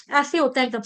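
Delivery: usual speaking voice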